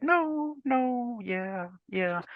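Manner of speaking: sliding from a squeaky no into a sheepish yeah; eager kid. Retold or imitated. Boy caught by mom asking for Stephen King, high pleading. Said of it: "And I'm like".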